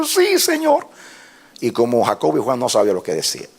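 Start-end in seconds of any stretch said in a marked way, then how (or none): 0.0-0.9 high-pitched imitation of a weeping reply; mock tearful. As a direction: answer God in a put-on crying voice, way up high. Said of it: "Y yo llorando, dije". (respuesta llorosa imitada, muy aguda)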